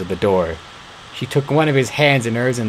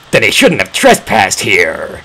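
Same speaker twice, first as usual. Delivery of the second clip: louder, furious and slow; angry roar; 2 semitones lower, 5 dB louder than usual. A narrator's reading. Beast roaring at the girl about her trespassing father. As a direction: bellow it at the girl. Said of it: "The voice was louder and angrier".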